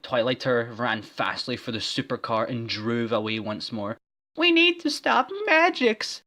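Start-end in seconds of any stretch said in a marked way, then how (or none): 4.4-6.2 very high, loud cry; cried. Acted. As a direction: squeal it urgently. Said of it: "she cried".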